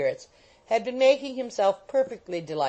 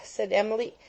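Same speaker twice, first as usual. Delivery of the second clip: ordinary delivery, nothing marked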